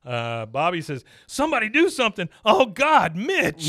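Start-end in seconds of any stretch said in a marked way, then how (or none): 1.3-3.7 much higher, louder and fast cry; imitating a panicked boy. Boy in the audience panics as the pig drowns, high frantic cry. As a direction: beg for help in a frantic rush. Bobby panics in retold episode.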